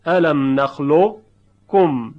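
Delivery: slow recited الم نخلقکم, heavy ق colour before a doubled ک; heavy qaf kept. Shown as a demonstration.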